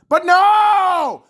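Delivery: real high, drawn-out angry cry; exasperated shout. Real, not acted. Fan ranting at players who blew the chance, exasperated shout. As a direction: yell it in outraged disbelief.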